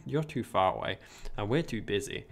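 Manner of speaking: usual speaking voice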